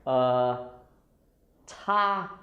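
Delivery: uh... TA-mic, syllable by syllable, long pauses; stressed T. Shown as a demonstration. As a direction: break "ta-mic" up and punch the T. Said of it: "That's why it sounds like".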